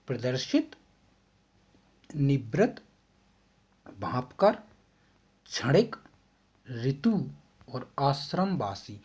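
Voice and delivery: प्रदर्शित, निवृत्त, भांपकर, क्षणिक, ऋतु, आश्रमवासी each said separately and clearly, with a pause between words; each word carefully articulated as a teacher's model for students to repeat; शुद्ध उच्चारण, हर शब्द अलग और साफ़; correct pronunciation. Shown as a demonstration. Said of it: "मैंने तो कर दिया है शुद्ध उच्चारण".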